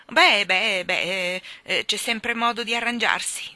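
loud, huffing character voice; blustering hake. A narrator's reading. Big hake waves away the money problem, blustering. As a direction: bluster through it loudly.

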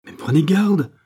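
high, loud warning; alarmed cry. A narrator's reading. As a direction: cry out to stop him. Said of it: "s'écria Louis seize".

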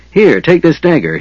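high, urgent call; shout. A narrator's reading. Tanis to Riverwind running by, shouting. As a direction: call out, pitched well up. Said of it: "he shouted to Riverwind".

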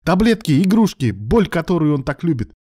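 high, loud accusing rant; angry barking. A narrator's reading. Alan accuses his lover about Ruslan, furiously loud. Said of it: "рявкнул Алан".

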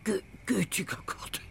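stunned, half-whispered gasp of recognition; hushed shock. Acted. Mother recognises the writer at her table, hushed shock. Mother learns who the guest is.

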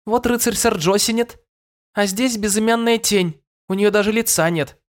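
put-on very high girlish voice; eager girl pleading her case; young princess voice. A narrator's reading. Madouc speaks to the king. (голос юной принцессы)

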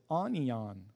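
"Onion" said as AH-nee-ahn; falling, confident misreading of "Onion" as ah-nee-ahn; misread word. Retold or imitated. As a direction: mispronounce "Onion" like a struggling reader, ah-nee-ahn. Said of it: "I said".

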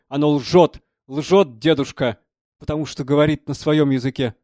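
loud, earnest pronouncement; sudden seriousness. A narrator's reading. Young artist lectures the old man on the human face, suddenly serious. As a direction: press the point with sudden gravity. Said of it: "с внезапной серьёзностью сказал".

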